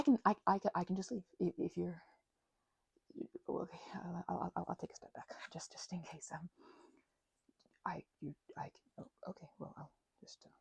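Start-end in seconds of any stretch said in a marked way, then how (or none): 3.5-10.5 whispered retreat, trailing off; awkward whisper. Acted. Vampire stepping back from the door, whispering awkwardly.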